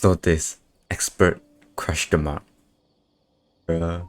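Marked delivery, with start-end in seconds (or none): none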